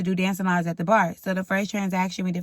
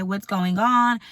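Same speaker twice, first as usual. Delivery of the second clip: usual speaking voice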